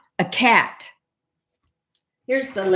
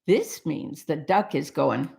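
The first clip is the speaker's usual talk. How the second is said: plain reading voice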